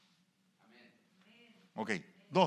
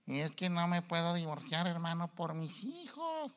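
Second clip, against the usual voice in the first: mock wife excuse; 4 semitones higher, 3 dB quieter than usual.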